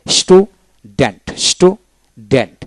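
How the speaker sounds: slow স্টুডেন্ট split as স্টু, then ডেন্ট; syllable by syllable. Shown as a demonstration. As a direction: leave a clear gap inside স্টুডেন্ট. Word read out split into syllables.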